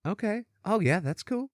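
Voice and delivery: lightly mimicked high, small replies; imitating co-host. Co-host humoring the tech nerd's Discord talk, lightly imitated. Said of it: "and Jade was like".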